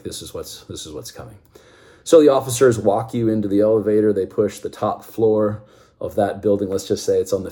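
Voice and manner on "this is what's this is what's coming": resigned murmur. Retold or imitated. Resigned to being chewed out.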